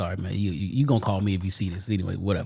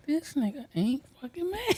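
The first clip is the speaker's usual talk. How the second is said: high, mocking voice of the others; mock onlooker; 6 dB quieter than usual. Mocking the people who want him mad, high voice. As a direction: squeak it out like a baffled rival.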